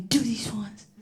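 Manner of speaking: soft whispered aside; parent whispering. Retold or imitated. Parent coming in to hand out discipline, in a hushed whisper. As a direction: murmur it like a hushed instruction. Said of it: "one parent will come in".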